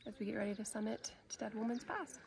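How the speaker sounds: breathy unvoiced whisper. Real, not acted. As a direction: whisper it to the camera. Snack break on the trail.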